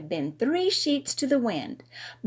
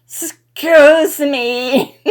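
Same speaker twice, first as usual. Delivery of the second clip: slow, loud, laughing excuse me; laughing apology; 5 semitones higher, 12 dB louder, much slower than usual. A narrator's reading. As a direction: say it through laughter. After a loud burp.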